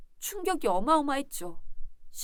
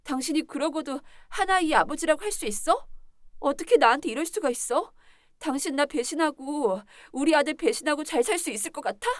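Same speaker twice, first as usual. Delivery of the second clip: higher, indignant questions; hurt reproach; 4 semitones higher, 4 dB louder than usual. Acted. Betrayed wife reproaches husband over their son, indignant. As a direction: throw each accusation at him, wounded.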